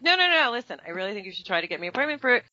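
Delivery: high, rushed pushing for the audition; eager insistence. Retold or imitated. Actress urging her agents, high and insistent. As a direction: talk fast and press the case.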